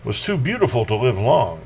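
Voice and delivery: measured, theatrical reading voice; poetry reading. Acted. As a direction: read it aloud like a stage poem. Reads a Baudelaire prose poem.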